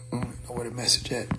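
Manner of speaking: whispered mutter while searching; whisper to self. Real, not acted. Whispering to himself while hunting for the message on his phone. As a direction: breathe it to yourself, searching.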